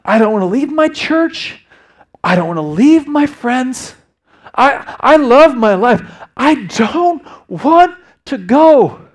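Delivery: high, loud, anguished pleading; impassioned protest through imitated tears; tearful son. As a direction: act out a distraught son, dragging the words out. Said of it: "And he just tears in his eyes".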